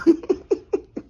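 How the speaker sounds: a loud high burst of laughter; cracking up at the punchline. Real, not acted.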